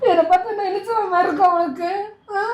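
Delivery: tearful, hurt question through crying. Acted. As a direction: ask it while crying. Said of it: "எதுக்கு நீ அழுவுற?". (அழுகையுடன் கேள்வி)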